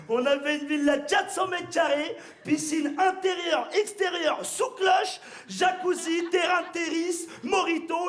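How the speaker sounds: high, loud gloating brag; bragging. Acted. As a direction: boast about the holiday. Bragging parody of the spiel.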